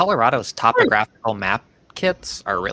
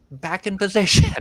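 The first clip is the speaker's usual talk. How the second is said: raised, higher mock call to players; mock sideline orders; 6 semitones higher, 4 dB louder than usual. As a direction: pretend to order the defence back.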